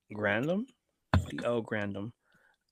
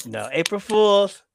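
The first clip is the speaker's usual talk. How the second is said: mock take-back; 10 semitones higher, 7 dB louder than usual. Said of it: "And it's like".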